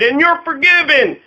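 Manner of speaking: high shouted call from the crowd. Retold or imitated. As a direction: call it out across the room. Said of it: "A member of the crowd shouted".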